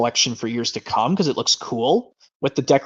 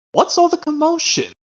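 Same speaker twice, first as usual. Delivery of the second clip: high, lightly put-on character voice; movie character impression. Character hearing the barking, mildly startled high voice. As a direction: ask it like a startled cartoon character. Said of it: "how about this one where it goes".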